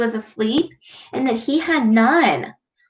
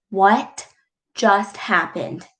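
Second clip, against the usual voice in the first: ordinary delivery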